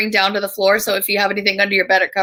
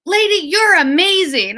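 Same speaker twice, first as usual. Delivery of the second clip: put-on much higher, gasping child's delight; excited toddler; 9 semitones higher than usual. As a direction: play the three-year-old thrilled by the lemons. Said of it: "she goes".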